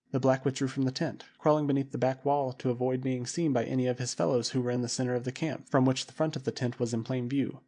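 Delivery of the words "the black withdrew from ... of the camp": ordinary delivery, nothing marked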